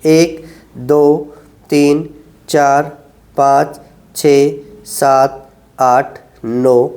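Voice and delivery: low, drawn-out counting through the hold; slow held count. Shown as a demonstration. Physio to patients, counting out a slow ten-second hold. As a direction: count each second slowly while holding the stretch.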